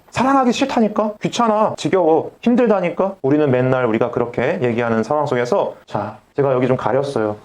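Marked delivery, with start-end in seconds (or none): 0.1-3.1 higher-pitched mock whining; 귀찮아하는 거절. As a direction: balk at being asked to love, acting fed up. 공감을 거부하는 사람 연기. (싫증 내며 투정 부리는 흉내)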